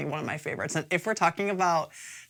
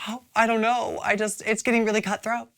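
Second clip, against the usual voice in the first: mildly flustered, higher-pitched quote; imitated spiralling; 6 semitones higher than usual. Host retelling how Cynthia spirals when challenged, mildly flustered. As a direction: lightly imitate someone starting to spiral. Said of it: "Cynthia just kind of spirals".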